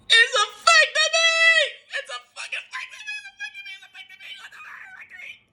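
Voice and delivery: very high wail fading to a squeak; mock wailing. Acting out emotional realization.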